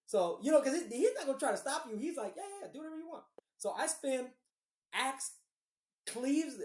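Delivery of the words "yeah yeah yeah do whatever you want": dismissive DM. Retold or imitated. DM dismissing player's plan.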